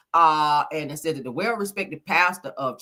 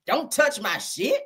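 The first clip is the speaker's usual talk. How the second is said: mock quote; 7 semitones higher, slower than usual. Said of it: "How you cuss out the bishop?".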